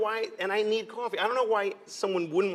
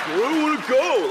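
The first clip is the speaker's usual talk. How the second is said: whiny orange; 9 semitones higher, 9 dB louder than usual. Retold or imitated.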